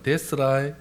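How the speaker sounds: light Jeolla accent; Jeolla dialect. Shown as a demonstration. Preacher showing Jeolla for it's fine, Jeolla accent. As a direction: say it like a Jeolla local. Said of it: "전라도는".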